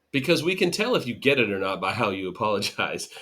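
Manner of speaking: ordinary delivery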